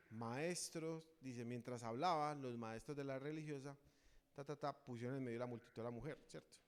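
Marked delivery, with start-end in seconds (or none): none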